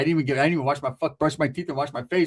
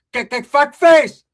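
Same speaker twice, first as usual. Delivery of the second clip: loud, slow, higher angry aunt; yelling tia; 7 semitones higher, 10 dB louder, slower than usual. Retold or imitated. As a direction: bark it like a furious aunt. Tia voiced on phone.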